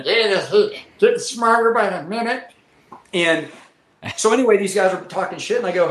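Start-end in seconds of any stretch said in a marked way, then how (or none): 0.0-2.5 tipsy bar voice. Retold or imitated. Drunk bar talk quoted.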